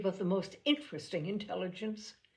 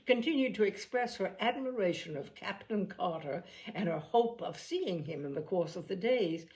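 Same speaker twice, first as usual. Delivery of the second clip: plain reading voice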